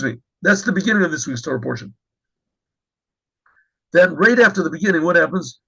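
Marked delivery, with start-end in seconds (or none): none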